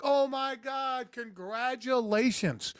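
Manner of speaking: high, drawn-out mock celebration; over-the-top slow, high cheer; gushing congratulations. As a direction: stretch it out like a big surprise welcome. Voicing coworkers greeting him on first day.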